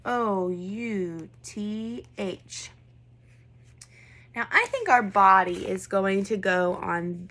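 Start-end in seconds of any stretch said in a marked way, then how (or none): 0.0-2.7 slow letter-by-letter spelling, softer. Shown as a demonstration. Teacher spelling "O U T H" letter by letter, slowly. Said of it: "We spell it M".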